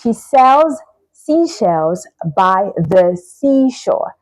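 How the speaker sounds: slow, deliberate tongue twister, each word separated; careful teacher's demo, word by word; slow demonstration. Shown as a demonstration. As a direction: say it slowly so every s and sh can be heard.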